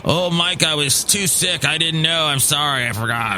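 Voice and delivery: put-on pleading voice, falling away; mocking whiny excuse. Boss mimicking employee's excuse for texting him, mock whining. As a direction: whine a feeble apology in mockery.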